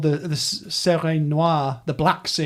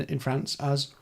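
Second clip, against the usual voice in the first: ordinary talk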